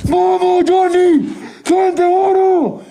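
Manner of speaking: high, pumped hype for the chat; hyped-up cheering. Real, not acted. Hyping chat during live event. (animando al equipo con euforia)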